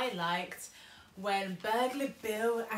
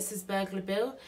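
usual speaking voice

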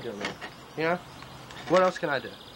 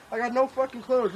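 normal talking voice

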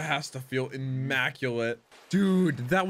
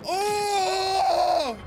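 excited shout. Real, not acted. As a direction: cry out at the big hit. Big hit in the fight.

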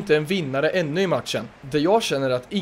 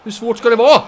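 raised, loud exasperation at players; frustrated shout; 4 semitones higher, 8 dB louder than usual. Real, not acted. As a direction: yell at your team, pitch climbing.

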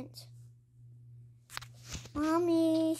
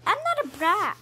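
loud, high, offended denial; indignant protest; 7 semitones higher, 9 dB louder, faster than usual. Pretend. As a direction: shout it back, insulted. Child protesting being called brat.

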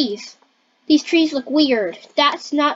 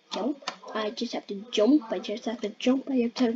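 very soft, low repetition; muttered self-coaching; 3 semitones lower, 9 dB quieter than usual. Pretend. Player psyching up for a jump, muttered self-coaching. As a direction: mutter it under your breath.

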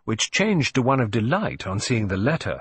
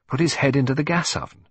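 plain reading voice

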